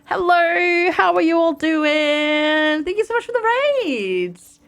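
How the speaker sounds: high, drawn-out greeting; excited welcome. Real, not acted. Streamer greets raiders arriving, high, stretched and excited. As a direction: stretch out a big cheerful hello.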